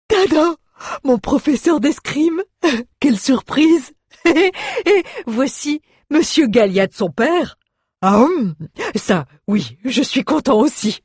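high, laughing, drawn-out character voice; excitable dwarf, delighted. A narrator's reading. Dwarf greets his fencing teacher and father, giddy with surprise. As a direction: chuckle through a squeaky, gleeful greeting.

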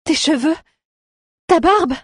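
high, rising shocked exclamation. Acted. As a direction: gasp it out in disbelief.

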